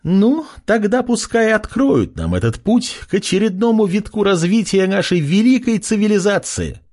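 high-pitched rallying proclamation; crying out. A narrator's reading. Woman ordering the way opened, crying out high. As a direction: call it out high, sustained to the end. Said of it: "Громко крикнула женщина".

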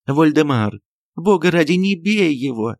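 high, pleading stage alarm; theatrical fright. A narrator's reading. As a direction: beg your husband with exaggerated dismay. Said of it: "кричала она с театральным испугом".

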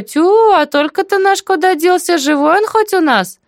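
very high, exclaiming auntie voice; astonished neighbourly questioning; surprised. A narrator's reading. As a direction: wonder aloud, pitched right up. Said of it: "удивилась тётка".

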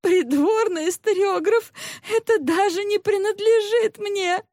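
very high, distressed female voice; tearful protest. A narrator's reading. Sobbing maid protests to the court historiographer, tearful and high. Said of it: "разразилась рыданиями".

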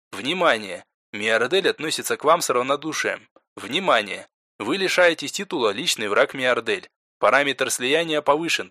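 soft, detached game system announcer; system announcement. Acted. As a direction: announce it as a game's system alerts. Game system messages read out.

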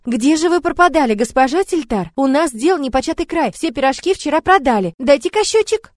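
high, loud eager chatter; a little girl babbling excitedly; rattling off. Acted. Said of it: "подскочила и затараторила".

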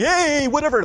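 loud, high careless holler; brash greeting. Acted. Someone barging in on a lion, brashly loud. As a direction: barge in with a cocky shout.

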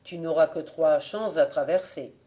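ordinary delivery, nothing marked

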